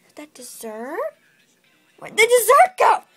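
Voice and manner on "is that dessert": soft greedy whisper. Acted. Princess sniffing out dessert, greedy whisper. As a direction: breathe it out hungrily.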